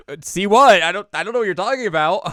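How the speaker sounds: loud, high-pitched panicked denial. Retold or imitated. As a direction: play dumb far too loudly. Ren feigns ignorance in panic.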